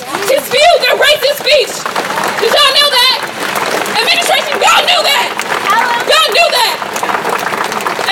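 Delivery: a rally speaker's fierce accusing yell; furious shout. Real, not acted. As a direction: hurl it at the administration over the crowd. Rally speaker confronting absent administration.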